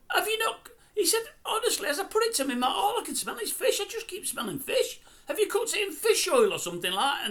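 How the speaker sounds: high, fast mock-posh whine; complaining diner. Hector complaining to the chef, high whining mock voice. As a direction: whine about smelling fish, squeaky.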